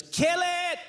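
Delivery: high shrill imitation of wife yelling; mock wife shriek. Ex-wife screams at husband to kill the mouse, high mock shriek. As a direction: squeal it like a panicked wife. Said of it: "She goes".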